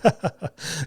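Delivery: soft, forced little giggle; awkward fake giggle. Dad humouring a stranger so he can pass, awkward giggle. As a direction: imitate a weak laugh to placate someone. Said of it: "awkwardly giggle at him".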